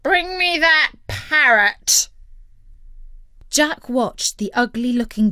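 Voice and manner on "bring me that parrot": high, loud, slow command; commanding pirate call; pirate captain's order. A narrator's reading. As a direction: holler an order across the deck.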